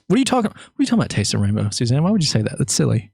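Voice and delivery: playful fake indignation; mock denial. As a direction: pretend he never said it. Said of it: "I never said it".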